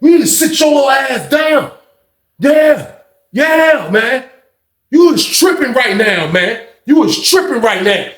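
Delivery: high-pitched heated scolding. Retold or imitated. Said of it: "him and Ronald kinda get loud".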